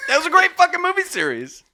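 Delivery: excited. Real, not acted. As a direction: gush about the movie. Defends film, laughing.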